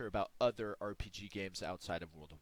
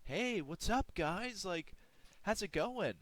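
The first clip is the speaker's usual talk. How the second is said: high, bright-eyed mock hello to the group; hammed-up cheery poser; fake friendly greeting; 10 semitones higher than usual. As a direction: put on an innocent, casual front. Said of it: "and you're like".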